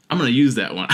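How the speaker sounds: ordinary delivery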